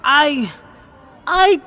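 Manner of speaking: halting, stuck on I and uh; frozen stammer. Acted. Boy freezing up in front of the heckler, stammering. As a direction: lose the words completely, tongue-tied. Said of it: "I froze".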